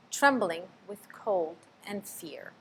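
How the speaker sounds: ordinary delivery, nothing marked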